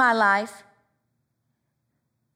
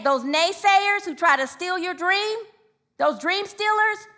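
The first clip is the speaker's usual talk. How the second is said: ordinary talk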